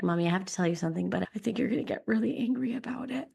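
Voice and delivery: put-on small, quiet, rushed child's voice; nervous kid bracing for trouble; choked up child. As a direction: play a scared little boy confessing. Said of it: "he was so choked up".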